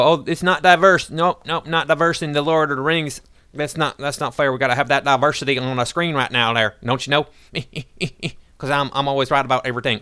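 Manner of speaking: high, whining send-up of a protester; mock complainer. Imitating someone demanding diversity on screen, high mocking voice. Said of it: "you wanna sit here and go".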